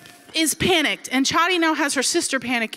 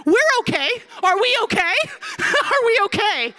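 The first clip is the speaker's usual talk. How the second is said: high, loud mock outrage; hammed-up indignant squawk; 12 semitones higher, 3 dB louder than usual. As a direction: throw the question back incredulously. Acted indignant reply to the guide.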